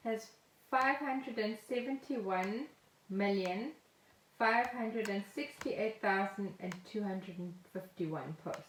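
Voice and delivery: normal talking voice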